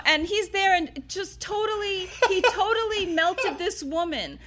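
can't hold back the laugh while telling it. Real, not acted. Recalling dad charming the lady, laughing hard.

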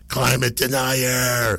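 slow, high accusing outburst; hammed-up indignant voice; mock outrage. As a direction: imitate a man about to blow his top. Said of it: "I thought this dude's head was gonna spin off".

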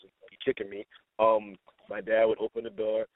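ordinary delivery

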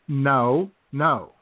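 ordinary delivery

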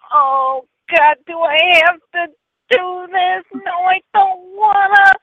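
high, reluctant whining; whiny computer voice. Retold or imitated. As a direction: whine like a lazy machine. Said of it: "it was like".

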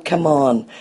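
ordinary talk, nothing marked

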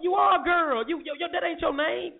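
high, squeaky mock indignation; mock outrage. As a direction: parody her getting offended, way up high. Mocking S2's outrage in high voice.

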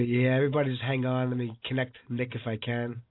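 usual speaking voice

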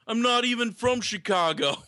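mock high indignant retort. Fan protesting he is not from Chicago, high mock indignation. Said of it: "And he's like".

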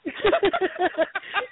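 high burst of laughter; long high giggling laugh. Real, not acted. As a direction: crack up at the retold remark. Laughs at retold remark.

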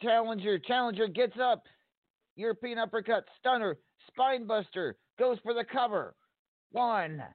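high, rising excited call; excited commentator. Acted. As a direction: count the pin in rising excitement. Announcer calls a title-winning pin.

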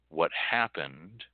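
ordinary delivery

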